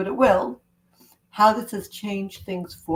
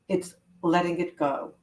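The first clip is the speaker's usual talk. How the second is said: ordinary delivery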